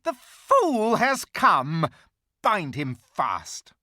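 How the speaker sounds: high, loud, slow witch voice; the witch gloats and orders her hags; gloating witch. A narrator's reading. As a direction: drag it out as a triumphant witch. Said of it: "she cried".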